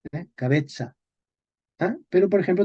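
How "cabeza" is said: cabeza said as ca-BE-tsa; medieval pronunciation. Shown as a demonstration. Teacher giving an example, medieval ts pronunciation. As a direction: give cabeza a ts for the z. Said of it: "Se pronuncia ts".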